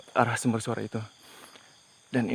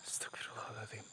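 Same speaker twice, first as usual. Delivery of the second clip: real hushed, breath-only whisper; whispered prayer; 22 dB quieter than usual. Real, not acted. Ghost hunter to himself after a noise, whispered prayer. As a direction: whisper it under your breath. Startled explorer prays under his breath.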